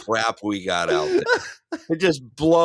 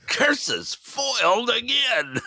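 high, slow cartoon villain curse; sputtering foiled villain; 12 semitones higher, slower than usual. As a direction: grumble it like a beaten baddie.

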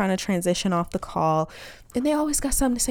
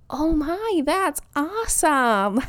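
higher, drawn-out polite gush; gushing interview voice; 5 semitones higher, much slower than usual. Retold or imitated. Code-switcher reacting to interviewer's story about her kid, gushing. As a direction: put on an overly impressed interviewee voice. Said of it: "Who the fuck says awesome like that?".

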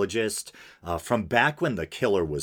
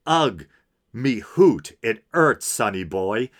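put-on Scottish accent; 3 semitones higher, 5 dB louder than usual. Podcaster reading out the letter's dialect line, Scottish accent. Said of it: "a Scottish accent".

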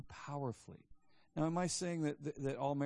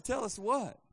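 insistent demand; 9 semitones higher, 5 dB louder, slower than usual. Retold or imitated. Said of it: "I said".

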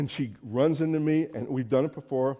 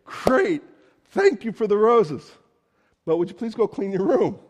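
eager son; 5 semitones higher, 5 dB louder than usual. Acted. Preacher voicing a son's bargaining.

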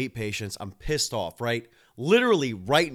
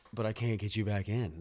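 usual speaking voice

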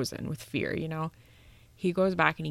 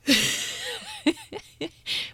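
high laugh; 3 dB louder than usual. Real, not acted. Laughs at shooting through door.

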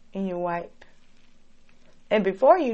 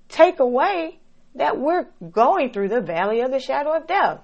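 ordinary talk

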